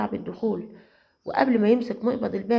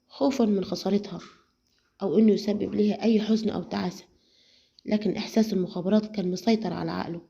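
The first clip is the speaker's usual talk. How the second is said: ordinary talk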